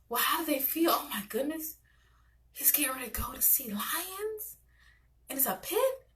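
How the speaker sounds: high, hushed storybook wonder; animated storytelling. Shown as a demonstration. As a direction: whisper the lions like a secret to a child. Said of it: "I'm very animated".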